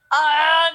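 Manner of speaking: sudden sharp hiccup, high and loud; a real hiccup bursting out. Real, not acted. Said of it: "I have the hiccups".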